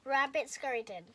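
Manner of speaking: ordinary delivery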